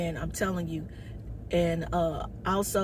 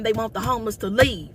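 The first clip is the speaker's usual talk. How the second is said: ordinary talk, nothing marked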